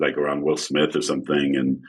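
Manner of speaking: ordinary talk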